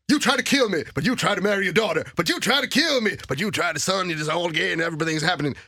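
high, breathless mock melodrama in English; overdramatic soap character. Mocking the show's overacting to a co-host, in high hammed-up melodrama. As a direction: ham up a soap star's hysterical accusations. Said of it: "så är det verkligen så här".

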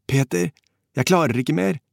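high, desperate plea; gurgling plea. A narrator's reading. Drowning man to his comrade, high desperate gurgled plea. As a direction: plead as you go under, mildly gurgled. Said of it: "gurglet Kåre".

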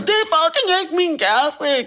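high, loud, slow protest; panicked yell. Retold or imitated. Patient yelling at paramedics in panic. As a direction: shout the correction through panic. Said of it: "kan jeg lige få sagt".

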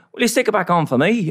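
a clumsy go at a Northern Irish voice; scene: imitating the chip shop man, bad Belfast accent; terrible Belfast accent. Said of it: "that was a terrible accent".

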